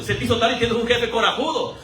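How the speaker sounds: ordinary talk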